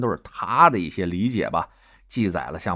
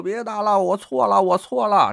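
higher, mock begging for mercy; pleading Confucius; 11 semitones higher, 4 dB louder than usual. Confucius begging God to stop the whipping, mock pleading. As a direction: beg like a beaten old man, hammed up. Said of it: "连连求饶".

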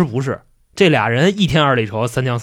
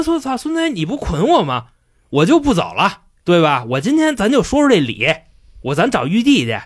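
high, cheeky Monkey King jeering; cocky monkey taunt; 6 semitones higher than usual. Retold or imitated. Wukong taunting Li Tianwang after being untied, high and cocky. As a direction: lord it over him up high.

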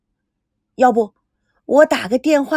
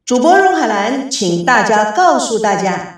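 slow, louder announcer read; stately slow broadcast outro; radio host sign-off; 5 dB louder, slower than usual. Acted. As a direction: draw out the closing disclaimer for the audience. Closing announcement to listeners.

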